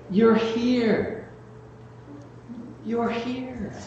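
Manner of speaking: soft, high, breathless wonder; tender whisper. Retold or imitated. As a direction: hush it, full of wonder. Dying mother greeting family.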